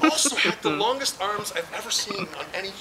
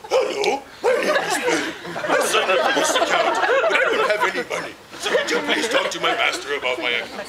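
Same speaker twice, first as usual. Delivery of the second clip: very high, loud, laughing cartoon dog voice; Scooby-Doo impression; 10 semitones higher, 4 dB louder than usual. Caller pretending to be his dog on the phone, cartoonish Scooby-Doo. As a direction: squeak it out like a cartoon Great Dane. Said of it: "his best Scooby-Doo impression".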